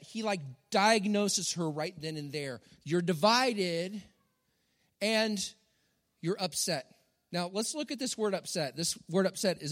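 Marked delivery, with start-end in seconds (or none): 2.8-7.0 slow emphatic words. Shown as a demonstration.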